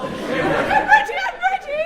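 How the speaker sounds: high, loud, laughing "I'm ready!"; eager shout. Acted. Comic playing an eager volunteer, loud and high. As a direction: burst out like a keen volunteer.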